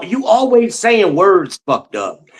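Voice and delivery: usual speaking voice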